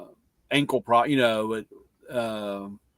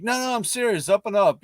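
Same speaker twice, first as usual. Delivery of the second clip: high-pitched earnest protest; retold insistence; 8 semitones higher, faster than usual. Coach to doubting father about recruiting his daughter, retold insistence. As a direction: imitate a man hurriedly vouching for himself, higher. Stranger insisting he is genuine, acted.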